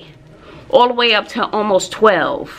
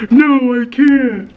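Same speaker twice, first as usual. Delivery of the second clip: louder tearful refusal; imitating whiny teen; 4 semitones higher, 5 dB louder, much slower than usual. Daughter refusing her mother, tearful and louder. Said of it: "She starts crying".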